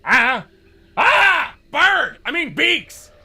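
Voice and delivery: excited shout. Acted. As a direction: shout in surprise at the sky. Spotting arriving friends.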